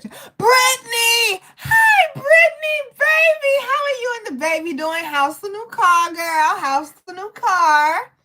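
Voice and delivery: high, loud, drawn-out squeal of hello; shrieking hello to a friend in chat; excited greeting. Real, not acted.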